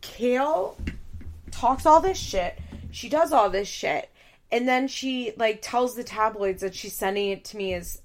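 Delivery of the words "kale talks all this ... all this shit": imitating Janelle. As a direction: vent like a fed-up daughter. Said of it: "what Janelle means when she says".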